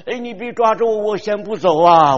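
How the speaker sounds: a frightened widow suddenly shouting; startled alarm. Retold or imitated. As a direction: yelp it as if something grabbed your sleeve. Widow startled, sleeve caught.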